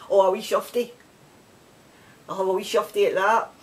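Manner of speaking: ordinary talk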